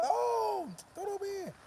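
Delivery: put-on very high, playful girl's answer; hammed-up girl reply; mock girl reply. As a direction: answer brightly in a comic high voice. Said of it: "they just".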